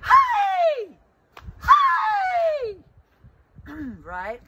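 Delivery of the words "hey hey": high loud call across a river. Shown as a demonstration. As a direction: call hey to a friend far away. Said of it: "calling out for your friend across the river".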